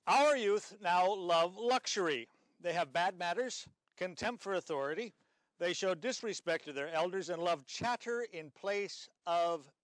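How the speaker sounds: slow, loud, emphatic reading; quote reading. Shown as a demonstration. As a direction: read the quote with grave emphasis. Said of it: "Let me read you a quote".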